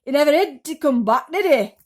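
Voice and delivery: loud, sneering aunt voice; nasty Aunt Sponge. A narrator's reading. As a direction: sneer about the missing boy. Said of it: "Aunt Sponge said".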